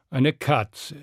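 ordinary delivery, nothing marked

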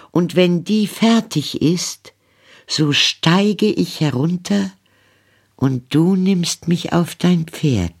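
put-on high, light girlish voice, eager; a hopeful young girl making plans; Rapunzel, young maiden. A narrator's reading. Rapunzel speaks to prince.

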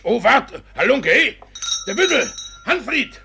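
loud, high yell after a runaway rascal; furious high shout; angry shout. Acted. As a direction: bellow at the scoundrel to stop. Judge yells at fleeing rascal.

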